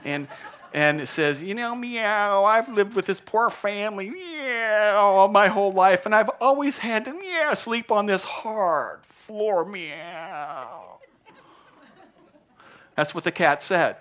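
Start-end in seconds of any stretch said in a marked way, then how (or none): 1.4-11.0 high, drawn-out, meowing complaint; hammed-up pitiful cat voice; whining cat. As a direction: whine like a hard-done-by cat. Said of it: "It starts whining like cats do".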